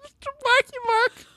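very high squeaky echo; high mimicking. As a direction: squeal it back playfully. Mimicking host fondly.

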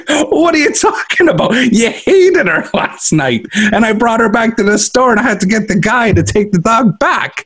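high, incredulous mock outrage; exasperated husband. Husband protesting to his crying wife in mock outrage. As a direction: play it high with disbelief. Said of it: "I'm like".

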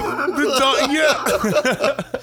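high voice breaking up in laughter; laughing hard. Real, not acted. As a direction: try talking while cracking up. Still cracking up at slip.

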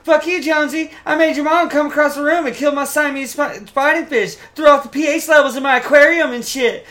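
very high, loud character squeal; Shorzy. Retold or imitated. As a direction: chirp a rival in a put-on high voice. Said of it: "that's what he says".

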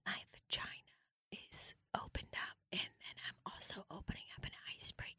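hushed parody ASMR narration; very soft, unvoiced mock-ASMR murmur; ASMR whisper. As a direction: whisper each act right into the mic.

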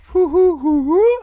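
high hooting owl call. Retold or imitated. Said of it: "It went".

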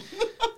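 short high laugh; high giggle. Real, not acted. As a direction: burst out giggling. Laughing at own rant.

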